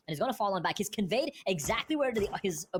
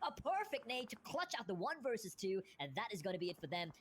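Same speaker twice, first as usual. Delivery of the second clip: ordinary delivery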